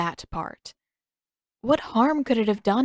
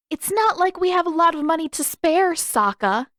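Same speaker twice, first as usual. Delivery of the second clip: pitched up, pushing back hard at her brother; indignant retort; 10 semitones higher, 5 dB louder than usual. Acted. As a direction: snap back that money is tight. Katara arguing with her brother.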